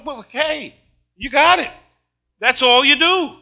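upbeat reassurance. Retold or imitated. Grandpa reassures the nervous grandson.

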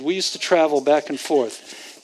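ordinary talk

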